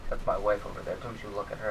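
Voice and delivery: put-on low, soft grumbling old man; lightly voiced jealous old man. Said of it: "this old man came up and he's like".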